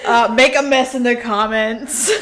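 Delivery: hammed-up YouTuber hype, building through laughter; mock hype. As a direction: play up the over-the-top plug. Mock hype sign-off to listeners.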